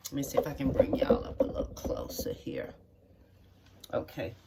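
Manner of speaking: hushed whisper while moving the camera; whispered aside. Real, not acted. Cook fixing the camera for viewers, whispering. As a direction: drop to a whisper and murmur it to yourself. Adjusting the camera mid-demo.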